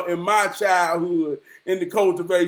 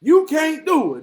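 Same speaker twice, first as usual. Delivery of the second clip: lightly voiced granddad, higher pitch; granddad's voice; 6 semitones higher than usual. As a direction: imitate granddad's challenge, pitched up. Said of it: "That's how he talked".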